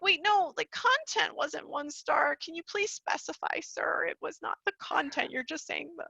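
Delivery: high, pleading protest to a reviewer; talking back to a bad review; mock indignant reply. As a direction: argue back with mock disbelief.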